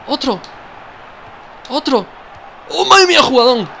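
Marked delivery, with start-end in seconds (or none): 2.7-3.7 sudden high excited cry. Real, not acted. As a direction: yell it out in delight. Celebrating a great play in-game. (grito de emoción)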